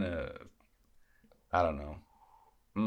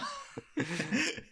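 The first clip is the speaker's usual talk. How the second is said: high laugh. Real, not acted. Laughs after impression.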